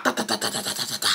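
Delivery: low, soft rapid knocking noise; quick muted "da da da" raps; imitated knocking. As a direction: drop low and patter out door knocks. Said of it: "So Chelsea go and she knock".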